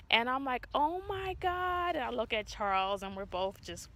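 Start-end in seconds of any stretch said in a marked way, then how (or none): 0.7-2.0 high, slow, awed gasp of words; stunned. Retold or imitated. Mother stunned at seeing twins. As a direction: let it hang in disbelief.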